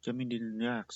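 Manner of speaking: ordinary delivery, nothing marked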